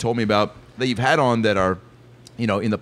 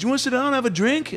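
high-pitched, lilting star impression; high mimicked celebrity voice. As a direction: greet in a high put-on voice. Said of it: "Paul McCartney's here".

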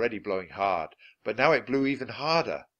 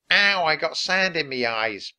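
higher, louder whine of complaint; pirate whine; 5 semitones higher, 6 dB louder than usual. A narrator's reading. Pinky complains about sand in the eyes, whining. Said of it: "Pinky whined".

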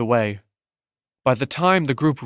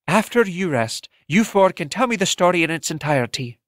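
a hint of a higher, motherly host; lightly voiced Lorna; 5 semitones higher than usual. A narrator's reading. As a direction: gently raise the voice for Lorna.

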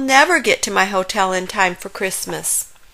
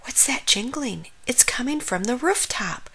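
soft, raised hush; whispered; 5 semitones higher, 6 dB quieter than usual. A narrator's reading. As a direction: whisper it softly, listening in suspense. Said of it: "whispered Bear".

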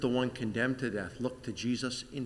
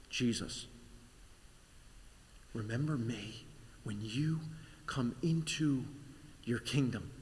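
soft plea; 5 dB quieter than usual. Acted. Dying criminal pleads softly.

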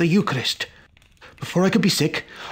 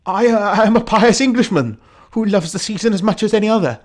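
higher, louder, stammered polite protest; flustered apology; 6 semitones higher, 6 dB louder, faster than usual. Acted. Pious Englishman excusing himself for intruding, flustered. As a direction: apologise hurriedly, expecting to be cut off.

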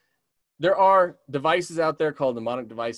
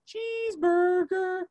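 chickadee call; slower than usual. Shown as a demonstration. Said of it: "cheeseburger bird".

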